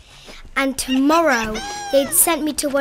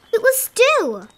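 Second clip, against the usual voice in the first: surprised reveal; 9 semitones higher, 5 dB louder than usual. Acted.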